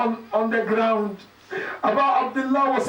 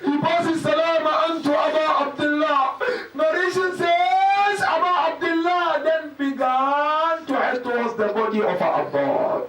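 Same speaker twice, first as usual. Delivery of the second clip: mournful recitation; 4 semitones higher than usual. Acted. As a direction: chant the tragedy, stretching the ends of phrases. Tearful majlis recitation.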